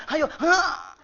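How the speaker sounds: high, breathy push cue; midwife urging. Retold or imitated. Midwife coaches the mother, high panting urge. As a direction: coax with a panting huff. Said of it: "bidannya ngomong".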